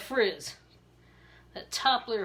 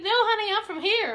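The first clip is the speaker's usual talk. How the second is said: higher, louder Maryland local voice; Maryland accent; 10 semitones higher, 6 dB louder than usual. Retold or imitated. Marylander telling her she's local, higher Maryland accent. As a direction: sound like a Baltimore townie. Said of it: "They'll be like".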